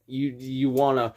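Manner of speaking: usual speaking voice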